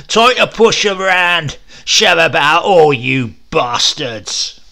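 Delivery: very loud, slow bellowing brute. Acted. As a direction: roar at the whole crowd. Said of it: "Now he roared louder still".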